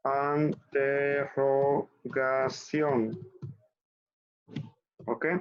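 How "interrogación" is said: interrogación drawn out very slowly; muy despacio. Shown as a demonstration. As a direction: stretch interrogación out for learners. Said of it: "aquí decimos".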